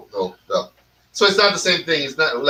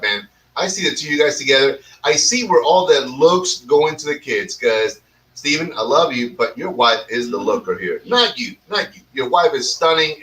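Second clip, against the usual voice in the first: usual speaking voice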